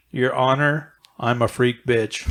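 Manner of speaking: slow monotone celebrity impression; Ben Stein. Reading rap lyrics on request, low deadpan drone. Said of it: "hear Ben Stein read this out".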